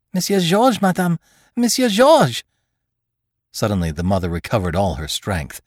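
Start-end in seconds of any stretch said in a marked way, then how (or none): 0.1-2.4 high, loud panicked calling; distraught nurse. A narrator's reading. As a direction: call out in alarm. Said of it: "She seemed distraught".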